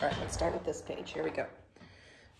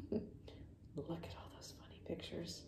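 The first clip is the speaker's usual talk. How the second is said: ordinary delivery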